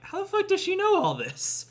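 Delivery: put-on puzzled by the old woman; confused disbelief. Confused viewer's own reaction.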